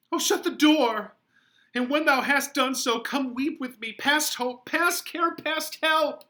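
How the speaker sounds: Juliet crying out high and loud in despair. Acted. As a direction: fall apart and beg for pity. Juliet breaks down to the friar.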